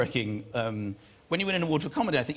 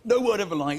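ordinary talk, nothing marked

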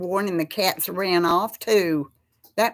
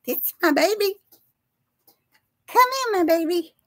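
cat voice; 10 semitones higher than usual. Real, not acted. Calling her cat over in a very high sing-song voice. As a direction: coo up high like calling a kitten. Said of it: "The cat voice".

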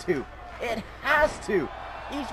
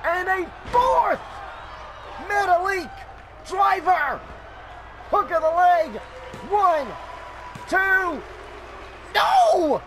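shouted, higher count ending in a huge yelled no; excited play-by-play; 7 semitones higher, slower than usual. Real, not acted. Commentator yelling the near fall to the audience, ecstatic. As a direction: build the count and explode on the kickout. Calls the fourth finisher and count.

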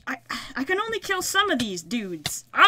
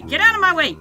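louder, higher get out of my way; frustrated shout; 5 semitones higher, 4 dB louder, faster than usual. Real, not acted. Gamer yelling at mobs blocking the path, loud and frustrated. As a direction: shove them aside with your voice. Shoving past mobs in a fight.

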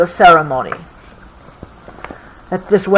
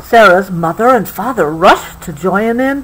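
ordinary delivery, nothing marked

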